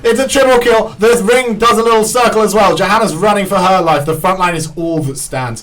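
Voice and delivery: high, loud hype casting; excited play-by-play. Acted. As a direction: scream the triple kill to the crowd.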